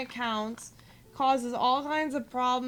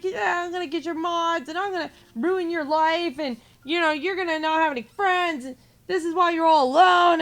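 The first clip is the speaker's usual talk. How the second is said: higher, louder sneering voice building to loud; mocking troll threat; 5 semitones higher, 7 dB louder than usual. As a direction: ham up his petty threats. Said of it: "And he's like".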